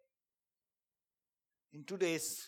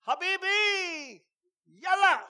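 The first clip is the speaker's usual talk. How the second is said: commander's shout; 13 dB louder, much slower than usual. Retold or imitated. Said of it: "and he did this".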